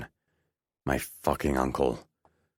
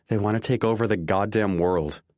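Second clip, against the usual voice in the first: exasperated outburst; 7 semitones higher, 4 dB louder than usual. A narrator's reading. Exasperated outburst about the enemy.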